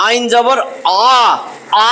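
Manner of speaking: আইন জবর আ drawn out very slowly, the আ thick and deep from the throat; deep throat a demo. Shown as a demonstration. Teacher modelling a heavy throaty আ for the student. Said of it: "মোটা কইরা এইখান থেকে".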